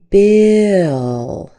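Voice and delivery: bill with an extra schwa before the L; drawn-out bill. Shown as a demonstration. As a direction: stretch bill, slide a little uh into the L. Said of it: "We put a little extra schwa in there".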